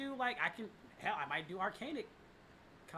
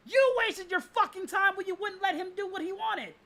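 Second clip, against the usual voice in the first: angry rant; 13 semitones higher, 10 dB louder than usual. Real, not acted. Ranting at Sony.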